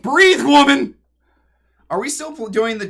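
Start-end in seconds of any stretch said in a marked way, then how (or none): 0.0-1.0 shouted, much higher and louder; loud self-command. Real, not acted. Streamer laughing too hard, shouting at herself to breathe. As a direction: yell at yourself to breathe between laughs. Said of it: "I can't breathe".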